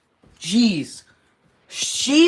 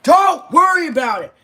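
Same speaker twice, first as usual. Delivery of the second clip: high, loud retold yell; shouting back at mom; 9 semitones higher, 7 dB louder than usual. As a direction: yell it back at her, higher and louder. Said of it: "I had to yell back".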